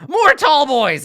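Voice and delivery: high, loud goblin cry; excited goblin. Acted. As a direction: shout it like a thrilled little goblin. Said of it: "there's, like".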